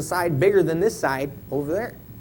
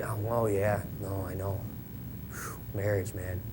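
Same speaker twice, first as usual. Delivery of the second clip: mock complaining; 9 semitones lower, 11 dB quieter than usual. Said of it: "they'll be complaining".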